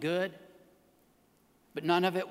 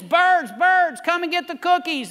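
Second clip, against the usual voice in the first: loud, high call to the birds; 11 semitones higher, 9 dB louder than usual. Acted. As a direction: summon the birds from across the yard. Frog calls out to the birds.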